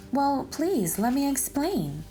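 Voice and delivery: ordinary delivery, nothing marked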